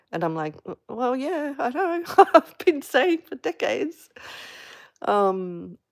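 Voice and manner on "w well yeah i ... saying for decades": higher, laughing "well yeah I know"; laughing retort. Retold or imitated. Answering the relative who finally understands, wry and laughing. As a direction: laugh it off in disbelief.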